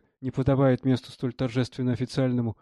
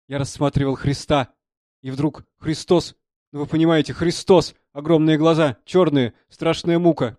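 louder, agitated storytelling; agitated confession; 3 semitones higher, 7 dB louder than usual. A narrator's reading. Young artist tells of seeing Christ in Spain, growing agitated. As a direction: build from earnest recollection to alarmed appeal.

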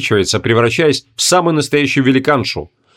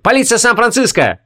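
police shout; 9 semitones higher than usual. A narrator's reading. Police announcement.

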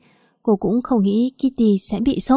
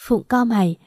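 usual speaking voice

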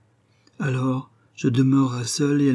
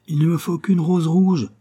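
higher, louder plea; crying out; 7 semitones higher, 5 dB louder than usual. Acted. Nightingale pleading with the rose tree, crying out. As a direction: cry it out in desperation. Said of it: "cria le rossignol".

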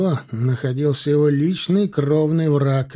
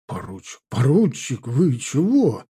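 nervous, disbelieving appeal; frightened mumble. A narrator's reading. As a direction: falter in alarm before a pistol. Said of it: "промямлил побледневший капитан".

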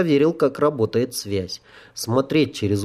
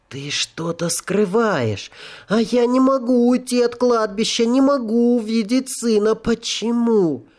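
pleading ghost voice; 9 semitones higher than usual. Acted. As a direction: plead like a lost spirit. Ghost pleads to see son.

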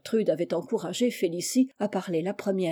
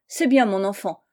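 ordinary delivery, nothing marked

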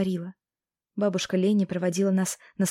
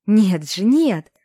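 cried out; 2 semitones higher, 8 dB louder, much slower than usual. A narrator's reading. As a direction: shout the denial, pulling free. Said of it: "выкрикнула". (громкий отчаянный выкрик)

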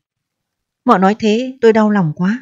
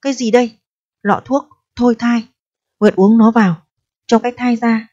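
plain reading voice

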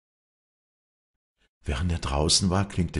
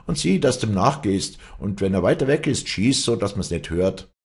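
raised, louder instructions; calling out; 5 semitones higher, 6 dB louder than usual. Acted. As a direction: call out the plan. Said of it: "rief Nikodemus".

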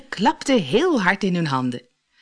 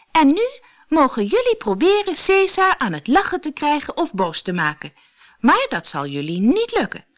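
put-on showman girl; 10 semitones higher, 3 dB louder than usual. A narrator's reading. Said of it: "Goed gedaan, Cesar".

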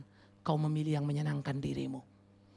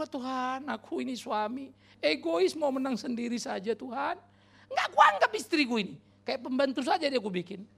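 husband's prayer; 7 semitones higher, 4 dB louder than usual. Acted. Husband confesses to God, raised and pleading. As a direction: pray as the selfish husband owning up. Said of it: "Tapi suami-suami juga".

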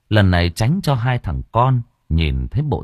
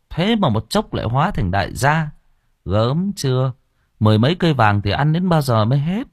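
lightly voiced, higher-pitched village gossip; gossiping villager; 6 semitones higher than usual. A narrator's reading. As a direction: gossip about a neighbour's sudden money. Villagers gossiping about the father. (giọng dân làng bàn tán)

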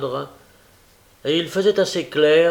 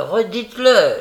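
higher, louder furious repeat; foaming with rage; 6 semitones higher, 7 dB louder than usual. A narrator's reading. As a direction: burst out, raging, on the repeat. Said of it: "écumant de rage".

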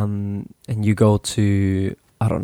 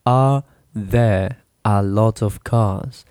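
"Are there a lot of cars?" said slowly, word by word; teacher spelling out "Are there a lot of cars?" piece by piece; broken down slowly; slower than usual. Shown as a demonstration. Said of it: "you break it down".

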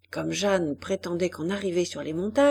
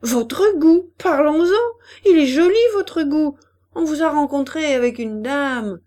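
raised, indignant voice, loud and high; angry scorn, pitched up; really angry; 5 semitones higher, 6 dB louder than usual. A narrator's reading. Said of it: "vraiment en colère".

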